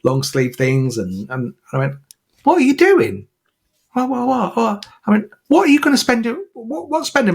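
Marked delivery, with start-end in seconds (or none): 2.4-7.4 much higher and louder, incredulous outburst; a stunned mum confronting her daughter's spending; exasperated mum. Retold or imitated. Mum retells scolding daughter.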